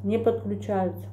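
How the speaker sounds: chewing between words; speaking with food nearby; talking while eating. Real, not acted. Seen 1: Holds bitten bun throughout, chews between phrases, lifts it to mouth.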